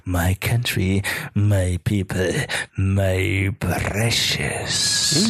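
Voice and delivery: low, creeping, very slow at the end; gollum-like gloat. Acted. The prime minister gloating over her country, low and creepy.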